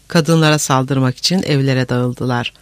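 ordinary delivery

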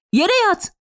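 high urgent warning cry; Fevzi yelling. A narrator's reading. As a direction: call out a sudden order to take cover. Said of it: "diye bağırıp".

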